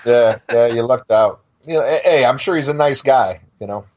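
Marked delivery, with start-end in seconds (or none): none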